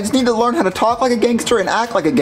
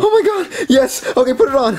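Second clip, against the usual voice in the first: much higher, thrilled squeal; 7 semitones higher than usual. Acted. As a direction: squeal with joy over the chain. Getting a gold chain.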